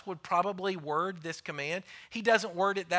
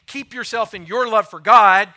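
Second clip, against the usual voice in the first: mocking wrong version; 4 semitones higher, 16 dB louder than usual. Shown as a demonstration. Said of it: "He doesn't say".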